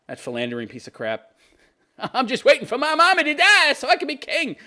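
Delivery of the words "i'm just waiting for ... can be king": mocking prince voice. Voicing the prince.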